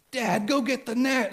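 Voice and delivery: mock the son pleading for the net; insistent son. Son insisting about fish.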